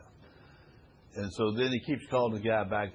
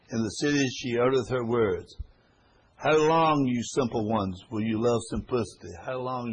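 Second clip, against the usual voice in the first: scripture reading building to a call; 6 dB louder than usual. Acted. Reads scripture aloud.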